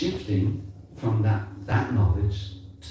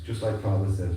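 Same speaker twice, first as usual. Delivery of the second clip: ordinary talk, nothing marked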